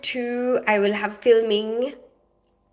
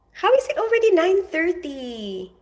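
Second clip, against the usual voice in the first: shocked at the time; 8 semitones higher than usual. Real, not acted. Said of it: "shocked at time".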